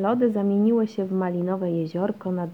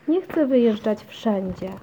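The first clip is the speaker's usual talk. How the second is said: mildly raised, louder objection; child's protest cry; 3 semitones higher, 6 dB louder than usual. A narrator's reading. Basia argues with mum over travelling, crying out. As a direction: protest out loud like a stubborn child. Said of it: "krzyknęła Basia".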